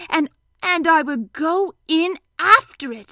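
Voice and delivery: high, loud, drawn-out excitement; excited Pooh. A narrator's reading. Pooh jumping into Piglet's honey plan, slow excitement. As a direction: savour each word, slow and excited. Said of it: "said Pooh excitedly".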